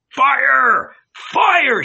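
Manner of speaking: very high, loud shouting; mob shout. A narrator's reading. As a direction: roar it like a mob. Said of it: "shouted the mob".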